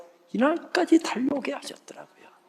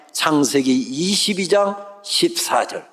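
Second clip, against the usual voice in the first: slow pronouncement; slower than usual. Retold or imitated. God announces verse slowly.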